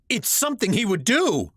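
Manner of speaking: high, heated outcry; crying out. A narrator's reading. Son arguing back at his mother about his father. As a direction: fire back hotly. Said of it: "I cried".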